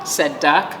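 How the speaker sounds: ordinary delivery, nothing marked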